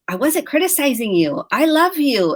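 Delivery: defensive protest. Retold or imitated. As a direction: insist defensively on innocence. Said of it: "And then I wanna get defensive".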